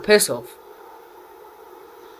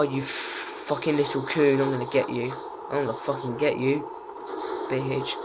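ordinary delivery

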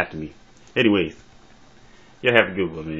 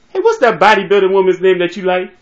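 loud, high-pitched needling question; teasing call-out; 8 semitones higher, 7 dB louder than usual. Acted. Teasing shout in a two-voice routine.

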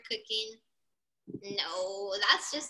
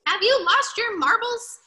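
raised, loud disbelieving question; incredulous; 7 semitones higher, 6 dB louder than usual. A narrator's reading. Reader voices Dog doubting Rooster's cooking, incredulous. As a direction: challenge it in story-time disbelief.

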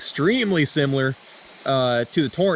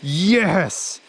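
cheering; 8 semitones higher, much slower than usual. Real, not acted. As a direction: whoop with triumph. Celebrating the catch.

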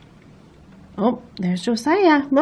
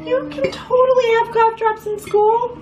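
incredulous; 12 semitones higher than usual. Real, not acted. Arguing with daughter.

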